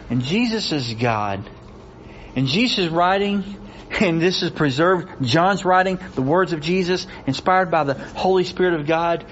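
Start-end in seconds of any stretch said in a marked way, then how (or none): none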